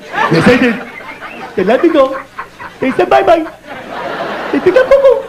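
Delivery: goofy coo climbing to a squeak; idiotic baby talk. Acted. Comic telling his baby he got fired, idiotic baby talk. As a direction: break bad news in silly baby babble. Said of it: "a license to talk like an idiot".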